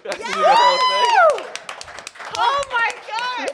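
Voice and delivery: thrilled, high shout; excited shout. Real, not acted. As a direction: cry out in delight. Moved by the performance.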